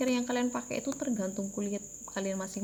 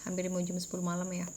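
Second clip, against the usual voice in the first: sleepy late-night voice, words drooping; 2 semitones lower, 8 dB quieter than usual. Real, not acted. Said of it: "udah ngantuk banget". (suara ngantuk, lesu dan pelan)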